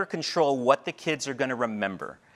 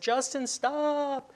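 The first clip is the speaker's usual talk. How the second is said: put-on high, slow, drawn-out yell after a child; yelling stop; 11 semitones higher, 4 dB quieter, much slower than usual. Student teacher yelling after a boy running toward the street, high drawn-out yell. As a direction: call him back before he reaches the street. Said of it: "And I yelled after him".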